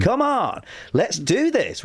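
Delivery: high-pitched mock pep; rally them like a primary school teacher; super-positive warm-up. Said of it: "that really positive".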